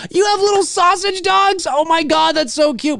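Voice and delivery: high, gushing squeal over cute dogs; delighted high-pitched gush. Real, not acted. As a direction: coo like you just saw puppies.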